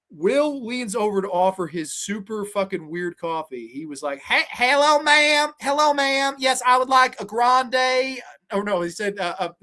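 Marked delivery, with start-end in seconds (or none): 4.2-8.2 high, overly polite put-on voice, slow at the end; prissy, sing-song drive-thru request; mimicking Will's order. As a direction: play a fussy customer sweetly ordering a grande. Imitating Will ordering coffee.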